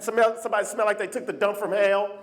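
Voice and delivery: normal talking voice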